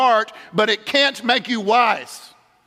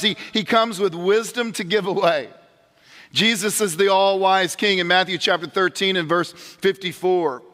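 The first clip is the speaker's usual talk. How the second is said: usual speaking voice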